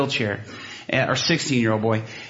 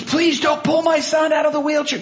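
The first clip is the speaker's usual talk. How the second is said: worried mother; 13 semitones higher than usual. Retold or imitated. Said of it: "she was afraid".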